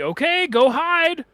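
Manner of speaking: put-on high, slow sing-song to a child; playful to children. Ghost hunter playing with child ghosts.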